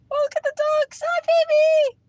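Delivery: very high, loud squeal of delight. Real, not acted. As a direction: gush over a cute animal in a high voice. Spots ducks, coos at them.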